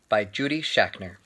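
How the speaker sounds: plain reading voice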